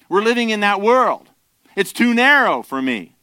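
fervent pulpit peak; full-force preaching. Acted. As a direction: press the congregation hard.

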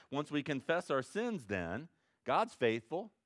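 ordinary talk

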